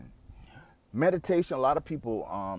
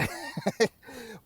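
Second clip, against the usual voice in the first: breathy laugh; 10 semitones higher than usual. Real, not acted. Laugh after hell no.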